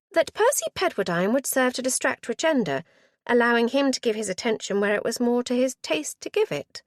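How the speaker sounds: put-on high, bright eager girl voice; eager young niece. A narrator's reading. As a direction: play the keen niece, rushing.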